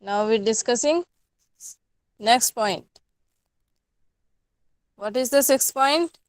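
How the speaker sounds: slow, spelled-out dictation to students. Acted. Teacher dictating the next point slowly to the class. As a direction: dictate it so they can write.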